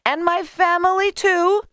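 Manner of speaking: high, insistent add-on; high-pitched neighbour's demand; eager woman. A narrator's reading. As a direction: tack it on in a high voice. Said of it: "she added".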